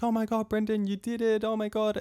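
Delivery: high-pitched imitation of amazed friends; imitated amazement. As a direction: mimic excited onlookers, voice lifted high. Friends cheer his jump.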